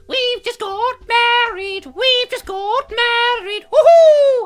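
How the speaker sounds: squealing falsetto, very high and loud; giddy royal newlyweds. Retold or imitated. Royal couple driving down Pall Mall, squealing high falsetto celebration. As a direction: shriek it like a giddy cartoon newlywed.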